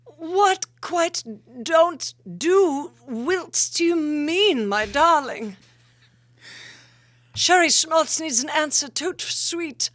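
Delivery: high, loud theatrical vamp. Pretend. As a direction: play the grand sultry diva. Vamp character Sherry Schmaltz answers.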